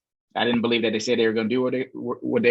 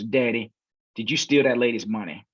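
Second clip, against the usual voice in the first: ordinary talk, nothing marked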